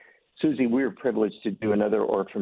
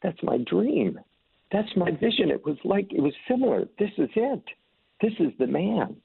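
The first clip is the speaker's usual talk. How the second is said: much higher, an emotional rush of recognition; mock-choked awe, pitched much higher; 10 semitones higher than usual. A young man recognizing his dream in scripture, higher and moved. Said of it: "this man is getting choked up".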